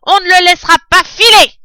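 hurlé, bien plus aigu, fort et rapide; martèle la menace en criant; cri rageur. Acted. Bin crie sa détermination. (Bin crie, déterminé)

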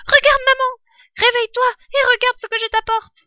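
high, loud little fox cub, excited; a young cub bursting with news; eager fox cub. A narrator's reading. Fox cub waking his starving mother, eager high voice.

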